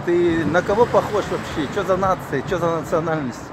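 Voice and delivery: usual speaking voice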